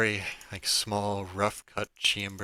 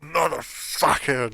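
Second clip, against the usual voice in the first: sudden higher, louder furious yell; angry outburst; 8 semitones higher, 8 dB louder than usual. Acted. Guard struck from behind yells out in fury. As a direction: bellow it as if just headbutted. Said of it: "and you hear".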